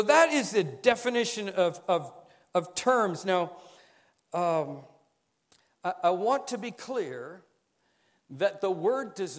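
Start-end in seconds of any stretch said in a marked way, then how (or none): none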